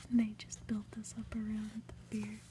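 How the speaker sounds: plain reading voice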